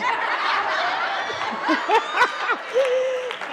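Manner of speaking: high, long hearty laugh; big laugh. Real, not acted. Laughing at punchline.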